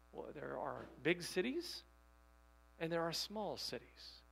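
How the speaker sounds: ordinary delivery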